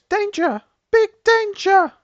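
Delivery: very high, loud little creature warning; bell-like alarm. Acted. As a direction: sound the alarm like a tiny bell. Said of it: "in a bell-like voice goes".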